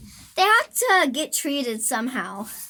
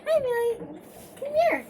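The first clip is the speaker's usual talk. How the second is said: pet voice; 7 semitones higher, 4 dB quieter, faster than usual. Real, not acted. Greeting her cat Millie, cooing pet voice. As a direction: coo at the kitten.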